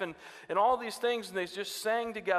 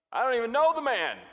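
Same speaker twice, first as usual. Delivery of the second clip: loud, higher angry denial; 7 semitones higher, 7 dB louder than usual. Retold or imitated. Peter denying Jesus to accusers, loud and defensive. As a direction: shout it, defensive.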